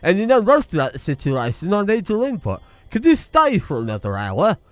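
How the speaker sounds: put-on northern accent through clenched teeth; northern English accent. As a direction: play a northern man speaking through his teeth. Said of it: "giving the stupid man a more northern accent".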